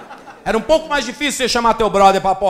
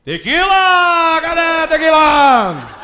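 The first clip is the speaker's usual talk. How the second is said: very slow, high drawn-out yell; party shout; 8 semitones higher, 7 dB louder, much slower than usual. Acted. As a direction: call for more tequila across the bar. Drunk man shouting for more.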